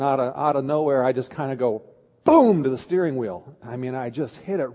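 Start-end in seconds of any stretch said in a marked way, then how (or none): 2.2-2.6 loud, abrupt boom; sudden boom. Retold or imitated. Father retelling how he hit the steering wheel, sudden loud boom. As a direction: punch it out like a sudden blow.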